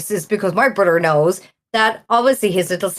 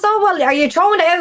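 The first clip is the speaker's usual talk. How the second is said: higher, hopeful question in a retold voice; hopeful brother; 9 semitones higher than usual. Brother asking the regular if he'll bin it, hopeful and high. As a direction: fish for the freebie, pitched up.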